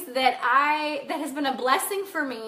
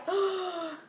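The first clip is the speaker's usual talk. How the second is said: shocked gasp; 5 semitones higher, 6 dB quieter than usual. Retold or imitated. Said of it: "And I was like".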